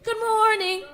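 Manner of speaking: mock eager hello; imitated DM suitors. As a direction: chirp it like a pestering admirer. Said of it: "thousands of them in my D M".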